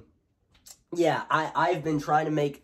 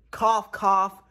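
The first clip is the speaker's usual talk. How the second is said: pointed fake cough; 8 semitones higher, 6 dB louder than usual. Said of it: "I was coughing".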